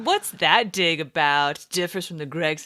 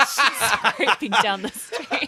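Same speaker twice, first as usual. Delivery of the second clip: laughing; 4 semitones higher, slower than usual. Real, not acted. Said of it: "teasing joke".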